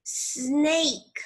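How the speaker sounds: "Snake" with a drawn-out hissing s first; long initial S. Shown as a demonstration. Teacher asks pupils to listen, long initial S. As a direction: stretch the opening s of "Snake".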